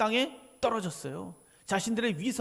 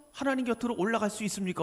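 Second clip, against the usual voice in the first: ordinary delivery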